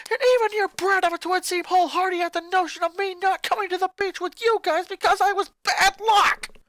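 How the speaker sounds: put-on high, loud furious boy shouting at family; a boy exploding at his mother; angry boy ranting. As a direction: snap back at Mom, furious. Lincoln bitter retort.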